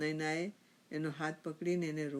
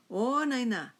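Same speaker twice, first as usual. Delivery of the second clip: higher, louder drawn call; 6 semitones higher, 8 dB louder, much slower than usual. A narrator's reading. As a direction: call out to the wife in another room. Calls wife from another room.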